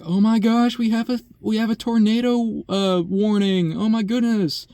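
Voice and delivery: mocking imitation of a panicked coworker; panicky coworker. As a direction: squeak it out like a frightened coworker overreacting to a storm warning. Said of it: "she comes in and she's like".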